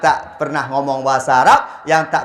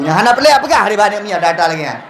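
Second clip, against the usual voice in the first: high and loud, laughing; excited laughing outburst; 12 semitones higher, 3 dB louder than usual. Real, not acted. (seru sambil tertawa, suara tinggi)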